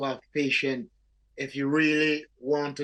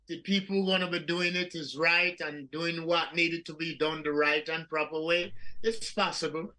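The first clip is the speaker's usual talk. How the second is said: usual speaking voice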